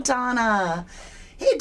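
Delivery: ordinary delivery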